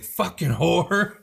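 retelling Luann scolding her drunk cousin, slow mock-angry jeer; mock angry slur. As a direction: spit it out slowly, hamming up the disgust. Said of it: "She's like".

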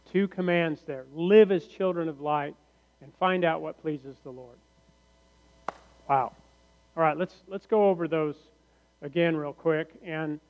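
ordinary talk, nothing marked

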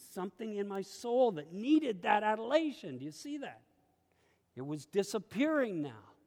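normal talking voice

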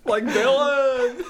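high, drawn-out mock squeal; mock exclamation. Podcast host clowning over melon with co-host, slow high mock squeal. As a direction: stretch it slowly, high and half laughing. Mocking the word again, amid laughter.

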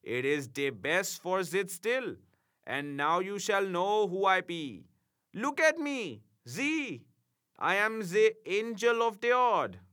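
put-on high, German-accented imp; proudly announce, thick German accent. A narrator's reading. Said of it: "said he".

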